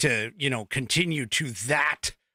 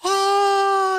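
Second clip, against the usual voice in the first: much higher, slowed gleeful cheering ending in a gasp; mock excited cheer; 12 semitones higher, slower than usual. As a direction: ham up the online fans celebrating. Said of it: "they were like".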